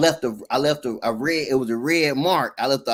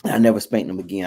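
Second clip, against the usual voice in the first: usual speaking voice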